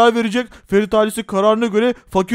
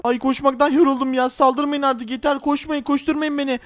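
normal talking voice

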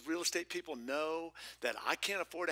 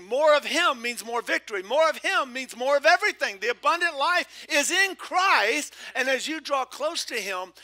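much higher, much louder ringing proclamation; full-force preaching; 12 semitones higher, 16 dB louder than usual. Real, not acted. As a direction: preach it like a rallying cry.

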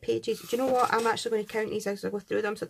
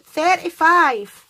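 excited; 5 semitones higher, 13 dB louder, much slower than usual. Real, not acted. Counting gift stickers, final count.

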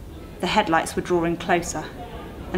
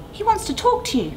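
urging Maggie. Acted. Maggie urges the silent driver.

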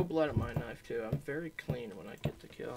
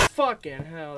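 real fright bursting out loud; startled yell; 10 dB louder, slower than usual. Real, not acted. Gamer startled by a jump scare, real shouted fright. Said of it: "that shit scared the fuck out of me".

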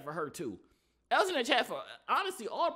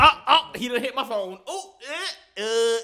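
startled; 4 semitones higher, 12 dB louder, slower than usual. Real, not acted. Camera phone gets hit.

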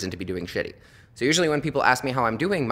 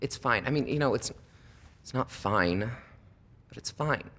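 softer, almost breathed reassurance; soothing the weeping cashier, softer; mock reassurance; 7 dB quieter, slower than usual. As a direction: hush her gently, half-meaning it.

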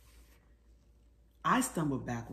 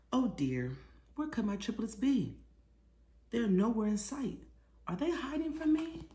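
playful, higher pretend fret; mock worry; 6 semitones higher than usual. Mother pretends to panic over missing triplets, mock fret. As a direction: ham up the worry for kids.

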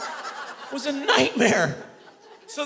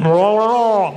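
loud wordless mwah-wah garble; booming cartoon trombone talk; Charlie Brown's teacher; 7 dB louder, slower than usual. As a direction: imitate an instructor nobody can understand. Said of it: "instructor is, uh, Charlie Brown's teacher".

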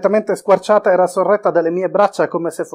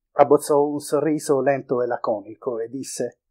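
ordinary delivery

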